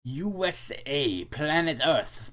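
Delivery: slow, deliberate words; slow careful. Acted. Elliot answering the clerk, slow and careful. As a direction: spell it out carefully.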